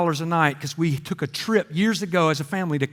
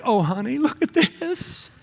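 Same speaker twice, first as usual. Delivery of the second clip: high, slow, swooning voice with laughter; giddy vacationer wife; 10 semitones higher, slower than usual. Retold or imitated. Wisconsin wife dreaming over the rental, giddy and high. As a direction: gush like a dreamy tourist, much higher. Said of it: "They're like".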